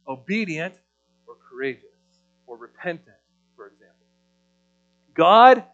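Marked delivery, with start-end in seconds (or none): 5.1-5.6 louder, higher and slow, pressing each word; emphatic proclamation. Acted. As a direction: proclaim it as the final point. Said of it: "emphatic climax".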